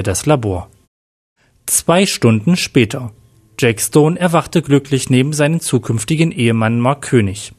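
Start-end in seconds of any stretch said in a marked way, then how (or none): none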